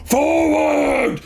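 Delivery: very high, loud, slow war roar; battle cry. Acted. Ogre rallying the boats, very high loud war roar. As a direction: charge everyone forward. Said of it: "and he goes".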